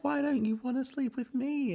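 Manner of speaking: high-pitched mock pleading; exaggerated high imitation; Brittany. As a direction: whine it way up high, imitating a castmate. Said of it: "And Brittany's like".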